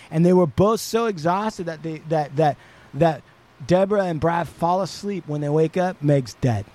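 normal talking voice

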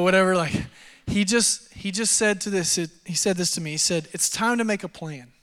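normal talking voice

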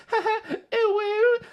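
high, squealing mock girl voice with a giggle; cutesy girl squeal. Girl gamer teasing her teammate, cutesy high squeal. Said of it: "she's going".

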